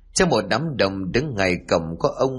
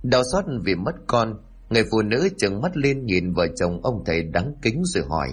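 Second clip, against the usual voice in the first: ordinary delivery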